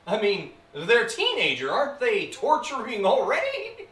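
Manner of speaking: loud, high exasperation; mock-exasperated demon; incredulous outburst. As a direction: exclaim it as an absurd objection. Demon mocks the idea, exasperated.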